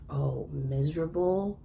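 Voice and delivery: a hint of a menacing mother; slow eerie mother voice; mother's voice. Mother quoted, dark menacing line.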